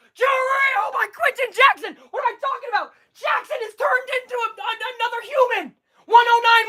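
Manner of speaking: high-pitched excited shouting; ecstatic play-by-play. Real, not acted. Fan streamer calling his team's go-ahead three, ecstatic. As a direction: voice leaps up in stunned joy. Team takes lead late in game.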